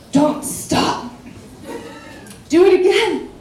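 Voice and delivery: mock-urgent command, building in force; urgent demand.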